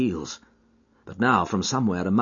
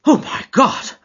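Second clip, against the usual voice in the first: high, loud gasped exclamation; shocked cry, pitched way up; horrified gasp; 7 dB louder than usual. A narrator's reading. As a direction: gasp it out in horror. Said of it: "I gasped".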